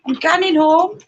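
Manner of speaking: high-pitched Geordie with a rising lilt; Geordie accent. Shown as a demonstration. Showing off Geordie by announcing the trip home, Geordie accent. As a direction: play up the Geordie, pitched right up. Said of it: "I can do Geordie".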